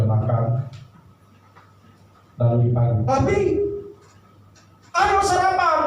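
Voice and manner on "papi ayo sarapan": high, sing-song call to breakfast; wife calling. Acted. (istri memanggil dengan suara tinggi)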